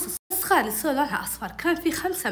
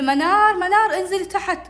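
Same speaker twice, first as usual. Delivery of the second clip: raised-pitch summons; calling out; 7 semitones higher, 4 dB louder, slower than usual. Retold or imitated. Older sister summoning the girl downstairs, calling out. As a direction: call her downstairs. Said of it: "تناديني أختي إيمان".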